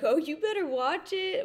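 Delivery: high, loud mock warning; loud, lifted "you'd better not" tease; teasing warning. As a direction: playfully warn a cocky friend, voice jumping up. Friends' teasing warning to the husband.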